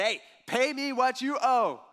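loud, high, slow threat; demanding servant. Acted. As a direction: bark the demand at the man you're choking. Servant choking his debtor.

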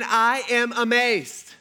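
bad amazed, drawn out in outrage; all caps anger. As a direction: spell out each word like shouting in all caps. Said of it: "this would be all caps".

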